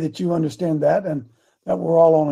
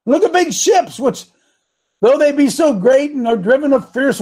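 animated, raised declamation; preaching scripture; 5 semitones higher, 4 dB louder than usual. Acted. Preacher reading James on the tongue, declamatory. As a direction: proclaim it with big peaks and stretched words.

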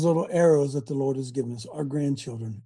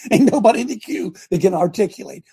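laughing; 4 semitones higher, 6 dB louder than usual. Real, not acted. Laughing in amazement.

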